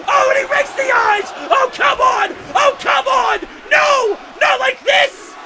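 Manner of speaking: furious commentator howl; outraged high yell. Real, not acted. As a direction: yell at the cheating heel. Commentator outraged at eye rake.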